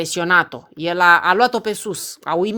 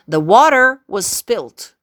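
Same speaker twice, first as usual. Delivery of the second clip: slow and a bit louder, each word set apart; slow demo; 5 dB louder, slower than usual. Shown as a demonstration. Teacher slowly models a sentence for students, slow and clear. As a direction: spell out the sentence slowly for learners. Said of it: "teacher demos sentence slowly".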